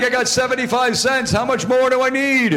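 high-pitched, eager simpleton; goofy childlike prank-call voice; idiot character voice. Acted. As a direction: ask like a hammed-up clueless innocent. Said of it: "how I came up with that character".

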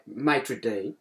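"maitre d'" as an English MAY-truh DEE; slow, low, anglicised "maitre d'"; plain English pronunciation. Shown as a demonstration. Friend corrects the storyteller's word, slow English "maitre d'". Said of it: "the maître d'".

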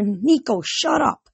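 high-pitched scolding; exasperated sister. A narrator's reading. Sister silences her babbling brother, exasperated. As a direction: cut him off, voice leaping on "shut up".

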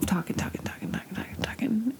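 mock whisper of endless talking. Friend mimicking the guys' chatter, in a whisper. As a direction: breathe the words out in a hushed drone.

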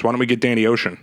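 ordinary talk

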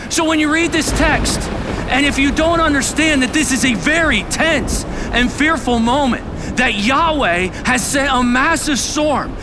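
high, loud, driving preaching; impassioned pulpit shout; preaching at full force. Real, not acted.

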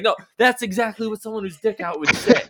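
high-pitched insistent refusal; mock suspicion.

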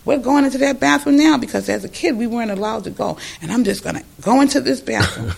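lightly voiced proud older women; determined ladies quoted. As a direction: claim the bathroom at last.

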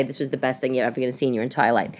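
low, hurried, deflated delivery; deflated retort. Retold or imitated. Hurt wife answering her husband, low and deflated. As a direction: rush it out low, with no fight. What she really said, feeling horrible.

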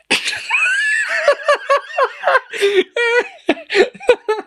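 real helpless shriek of laughter; huge laugh. Real, not acted. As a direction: burst into a howling laugh. Bursts out laughing after joke.

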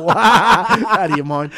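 slow, laughing high reply; laughing off the friend's crude question. Real, not acted. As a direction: let the laugh take over the words.

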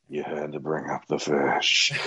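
low, slow grumble; grumbling complaint. Retold or imitated. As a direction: mutter it like a fed-up cohost. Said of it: "the whole episode can be like".

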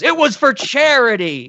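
high, slow, sheepish excuse; a high, slow guilty excuse; sheepish Bob Odenkirk. Retold or imitated. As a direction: squeak it out like a caught man pleading innocence. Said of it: "And Bob Odenkirk goes".

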